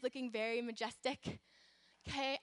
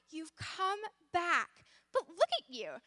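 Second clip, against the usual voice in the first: higher-pitched storybook amazement; joyful cry; 5 semitones higher, 3 dB louder than usual. A narrator's reading. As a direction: marvel at a returning friend. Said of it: "they cried".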